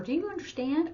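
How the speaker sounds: high, rising mock question; mock condescending. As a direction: mimic a patronising check-in. Mocking the phrase to avoid.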